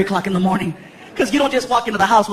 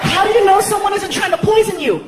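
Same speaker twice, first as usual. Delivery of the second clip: put-on girlfriend arguing; 6 semitones higher than usual. Girlfriend arguing about poisoned cake, high and incredulous. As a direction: voice the girlfriend winding up.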